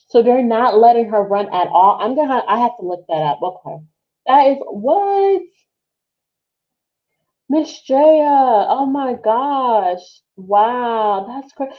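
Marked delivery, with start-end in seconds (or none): 4.3-11.7 higher, slowed, drawn-out what; stunned all over again; disbelief. Real, not acted. Streamer learns the runner's fate, higher and slowed in disbelief. Hearing more bad news.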